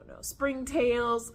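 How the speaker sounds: ordinary delivery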